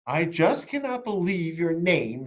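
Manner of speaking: high, slow, sneering schoolgirl; mocking child voice. A narrator's reading. Victoria teasing Chrysanthemum as the students line up, high and mocking. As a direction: drag it out in disbelief. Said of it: "Victoria said".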